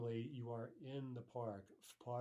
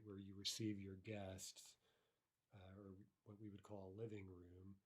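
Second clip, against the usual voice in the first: ordinary talk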